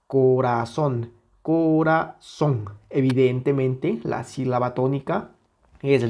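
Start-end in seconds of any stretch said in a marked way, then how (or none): 0.1-1.1 "Corazón" muy lento, con fuerza en "zón"; acento en la última sílaba. Shown as a demonstration. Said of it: "la que suena más fuerte". 1.4-2.7 "corazón" muy lento, sílaba por sílaba hasta "zón"; acento en la última sílaba. Shown as a demonstration. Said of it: "la que suena más fuerte".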